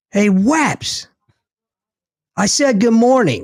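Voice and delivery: high-pitched called-out reprimand; retold yell. Sailor to weapons officer who ignores greetings, yelling. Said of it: "I yell at the top of my lungs".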